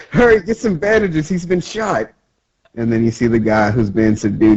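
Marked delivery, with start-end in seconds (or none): 0.1-2.1 high-pitched plea for help; high, urgent townsperson voice; mock urgency. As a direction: call for help in a high cartoon panic. Acted townsperson calling for help.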